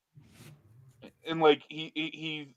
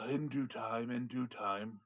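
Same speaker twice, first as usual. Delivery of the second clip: quiet wizard; 2 semitones lower, 9 dB quieter, slower than usual. Acted. Wizard deflecting after a sigh.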